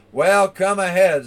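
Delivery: loud, fearless goat dare; bold challenge. Acted. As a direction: call the troll on without fear. Big goat dares the troll.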